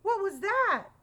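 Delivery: high, loud startled question. A narrator's reading. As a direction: jump at a sudden noise. Startled by tapping in story.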